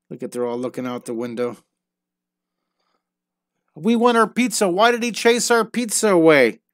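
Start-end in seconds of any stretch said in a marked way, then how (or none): 3.7-6.6 high, whiny crowd demanding pizza; higher-voiced townspeople grumbling for pizza; complaining townsfolk. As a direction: voice them like cranky villagers.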